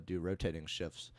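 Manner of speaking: ordinary delivery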